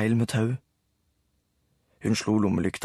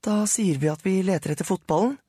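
Sara voiced; 9 semitones higher than usual. A narrator's reading. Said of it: "sa Sara".

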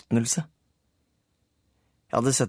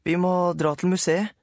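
narrator's younger self; 8 semitones higher than usual. A narrator's reading. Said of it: "sa jeg".

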